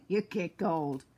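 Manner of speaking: chuckling through the words; laughing. Real, not acted. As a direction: laugh as you say it. Laughs at her own remark.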